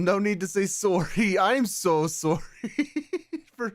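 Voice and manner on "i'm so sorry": joking Canadian apology; Canadian accent. Acted. Streamer apologises back and forth, mock Canadian accent. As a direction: lean on a Canadian vowel in "sorry". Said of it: "Why are you saying sorry like that?".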